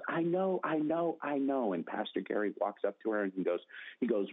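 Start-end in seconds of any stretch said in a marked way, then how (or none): 0.1-1.7 high, eager repeated "I know"; bursting to be picked, pitched up high; eager excitement. Retold or imitated. Lady calling out to the pastor, eager and high.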